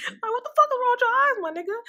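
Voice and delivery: laughing disbelief. Real, not acted. Vlogger cracking up at Terry Silver's eyes, laughing loudly.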